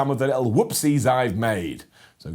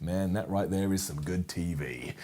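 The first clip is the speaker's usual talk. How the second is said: low aside; 8 semitones lower, 11 dB quieter than usual. Acted. Low aside after soap plot.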